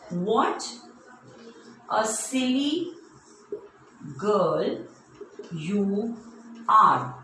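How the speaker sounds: long pause after almost every word; word-by-word dictation. Shown as a demonstration. Teacher giving children an exclamation sentence, slow word-by-word dictation. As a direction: dictate it haltingly for someone writing along. Writing example exclamation on board.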